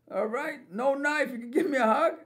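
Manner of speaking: high-pitched, tentative acceptance; acted-out wary surprise; surprised relief. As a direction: go up high, as if checking it is safe.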